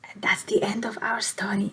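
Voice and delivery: soft, fast whisper; whispered ending. Real, not acted. Storyteller closing the tale for listeners, whispered fast. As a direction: hush it as the story closes.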